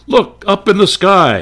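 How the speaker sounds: thrilled radio bystander calling out; excited announcer shout. Retold or imitated. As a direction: point up and call it out. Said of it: "then the announcer would say".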